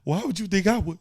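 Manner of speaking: high, pleading hurt protest; replaying his emotional plea mid-argument. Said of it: "I was like".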